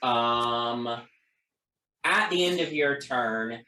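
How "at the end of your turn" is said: usual speaking voice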